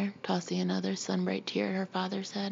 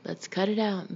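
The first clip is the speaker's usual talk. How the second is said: ordinary delivery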